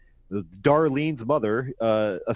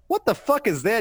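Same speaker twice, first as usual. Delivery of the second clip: mock shock; 9 semitones higher than usual. Buddy reacting in comic read.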